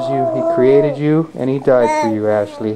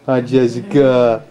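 dad gently greeting his newborn daughter; tender greeting; slower than usual. Real, not acted.

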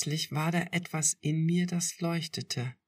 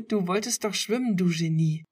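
higher, louder and fast teasing; giggling; 4 semitones higher, 7 dB louder than usual. A narrator's reading. Girlfriend teasing him in the water, giggling. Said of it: "kicherte sie".

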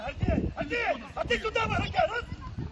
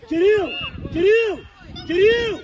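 shouting a name; 10 dB louder, slower than usual. Real, not acted. Coach calling a player repeatedly.

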